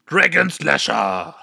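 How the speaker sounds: slow, dramatic anime move name; attack name call. Acted. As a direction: announce the special attack slowly. Character names its attack.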